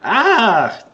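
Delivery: high, loud "ah" falling off; loud cry. Pretend. Game master cries out in play.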